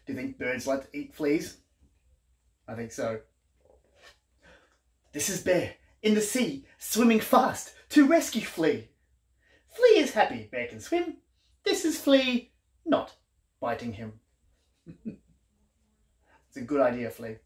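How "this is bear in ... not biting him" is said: lively, sing-song picture-book reading; reading to children. A narrator's reading. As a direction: read it like a bedtime book for little ones.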